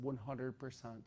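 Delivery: soft, slow deadpan; deadpan confirmation. Retold or imitated. Answering the brother in the hallway, soft slow deadpan. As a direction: flatly own it, quietly. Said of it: "I said".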